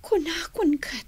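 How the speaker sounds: soft whispered aside. Acted. Young woman whispering to the maid about her aunt, whispered. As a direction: mutter it under breath.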